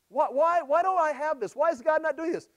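high-pitched, acted questioning; mock complaint. Believer asking God why not, mock complaint. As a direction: plead why, as a puzzled believer.

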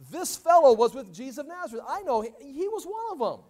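high-pitched, excited telltale; accusing. Acted. Girl telling the people about Peter, high accusing voice.

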